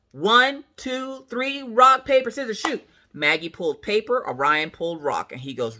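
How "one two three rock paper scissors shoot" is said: high countdown chant; game count-off. Retold or imitated. Mom running the tiebreaker, chanted count. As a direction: count it off on the beat.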